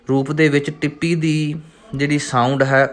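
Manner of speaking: ordinary delivery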